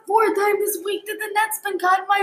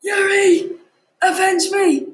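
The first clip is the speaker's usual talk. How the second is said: loud desperate last cry; dying cry; 3 dB louder, slower than usual. Acted. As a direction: shout it as you go under.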